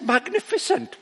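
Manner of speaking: incredulous echo. Retold or imitated. Incredulous reply to Godson.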